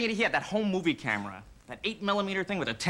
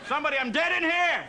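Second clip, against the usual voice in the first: calling for help; 11 semitones higher than usual. Pretend. Patient calls the nurse after the machine reads zero, mock alarm.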